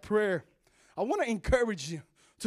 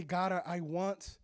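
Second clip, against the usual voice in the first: quiet prayer; 8 semitones lower, 10 dB quieter than usual. Shown as a demonstration. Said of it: "and say".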